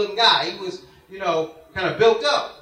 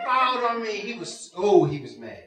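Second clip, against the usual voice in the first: normal talking voice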